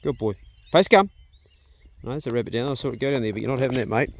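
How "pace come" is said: louder and higher command shout. Real, not acted. Owner calls dog a second time, loud shout. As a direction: bark the command so he hears.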